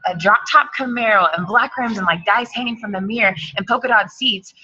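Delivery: ordinary talk, nothing marked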